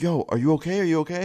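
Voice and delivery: high retold urgent questioning; alarmed checking. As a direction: imitate a friend anxiously checking on someone. Friend addresses screaming man.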